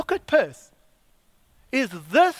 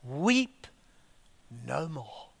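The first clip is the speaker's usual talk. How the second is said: soft, falling away to almost nothing; hushed reading; 6 semitones lower, 7 dB quieter, much slower than usual. Shown as a demonstration. Reading the elder's words to the church, very softly. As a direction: let the words fade out gently. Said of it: "look at these words".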